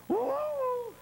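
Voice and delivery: very high falsetto woo from inside. Acted. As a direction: answer back sweetly in a high falsetto. Voice answers from cave.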